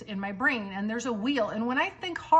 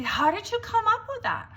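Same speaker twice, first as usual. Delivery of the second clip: a much higher, louder put-on voice for the moms; curious mom; 12 semitones higher, 4 dB louder than usual. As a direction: play the amazed stranger mom. Moms asking about name.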